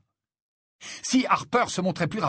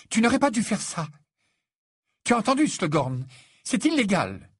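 voix plus aiguë, reproche; gronde-le, scandalisée; Hermione réprobatrice; 6 semitones higher than usual. Acted. Hermione scolds Harry. (Hermione reproche à Harry sa triche, voix aiguë et tendue)

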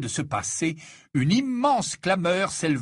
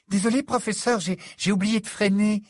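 ton dégagé; 6 semitones higher than usual. A narrator's reading. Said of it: "d'un ton dégagé".

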